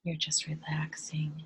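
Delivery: soft, hushed meditation voice with long pauses; guided meditation. Real, not acted. Guide leading listeners to relax and breathe, hushed meditation voice.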